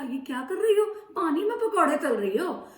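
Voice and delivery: monkey teasing granny; mocking question. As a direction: needle her with the question.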